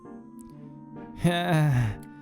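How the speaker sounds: high creature cackle; acted villainous laugh; gloating cackle. A narrator's reading. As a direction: cackle in triumph over the injured man. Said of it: "She cackles and throws the metal tool".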